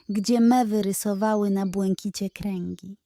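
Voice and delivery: ordinary delivery, nothing marked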